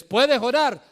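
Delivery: high, loud challenging question; rhetorical question. Acted. Rhetorical question then answer. ("¿puedes orar?" preguntado alto y fuerte)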